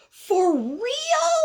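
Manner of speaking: high, incredulous squeal; amazed caterpillar. Acted. Caterpillar learns he can get wings, amazed. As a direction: shoot the question way up in amazement. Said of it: "And then he goes".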